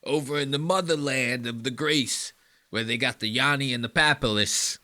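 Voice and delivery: doing Giannis with a put-on Greek accent; mock Greek accent. He goes.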